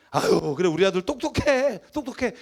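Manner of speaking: angry parent's sarcastic praise. Shown as a demonstration. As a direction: spit out the compliment in annoyance. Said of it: "화를 내면서".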